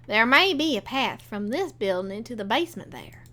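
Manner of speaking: usual speaking voice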